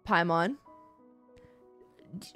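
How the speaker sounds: ordinary talk, nothing marked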